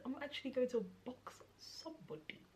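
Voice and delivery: unvoiced whisper under her breath; a hushed, half-joking whispered threat; whispered aside. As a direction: whisper the threat so mum won't hear.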